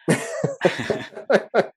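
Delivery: breathy rising laugh; a real embarrassed giggle. Real, not acted. Laughing at calling his posh voice a protection.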